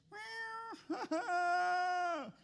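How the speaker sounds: shrill laughing shriek; high shrieking laugh. Retold or imitated. Pastor squeals while retelling the cat scare, high laughing shriek.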